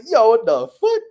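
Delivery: high, loud startled outburst; shocked yo what the fuck. Retold or imitated. Seeing a flag in Serbia, a loud disbelieving outburst. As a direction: blurt it out in disbelief. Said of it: "I'm like".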